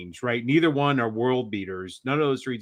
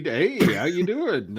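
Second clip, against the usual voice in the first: high creepy greeting imitation; 5 semitones higher, slower than usual. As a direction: lightly mimic a creepy caller. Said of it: "that was a creepy voice".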